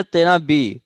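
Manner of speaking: ordinary talk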